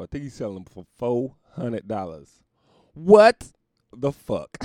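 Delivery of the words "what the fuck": loud, slow disbelief; slow outraged what. Acted. Hearing the shoe price, loud slow outrage. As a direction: drag it out in outrage.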